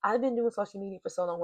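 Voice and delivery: quick, hurried delivery; brisk rerun of the sentence; sped up. Real, not acted. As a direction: rattle it off to save time. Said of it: "I'm gonna speed this up".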